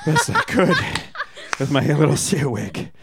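winded after dancing; breathless from dancing around; out of breath. Real, not acted. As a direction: puff the words out after dancing. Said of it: "I'm out of breath".

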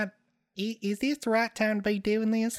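put-on high-pitched kid with a Southern accent; high Southern child voice. Shown as a demonstration. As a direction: talk like a little Southern kid. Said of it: "Demonstrate it for us".

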